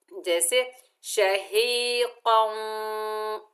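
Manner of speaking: शहीक़न drawn out, the nasal held long; recited with ghunna. Shown as a demonstration. Teacher demonstrating idgham with ghunna, slow recitation. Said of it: "हमने इदगाम के साथ पढ़ा".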